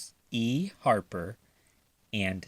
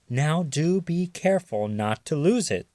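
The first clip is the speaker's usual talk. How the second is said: teasing; 6 semitones higher, 4 dB louder than usual. A narrator's reading. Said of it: "she teased him".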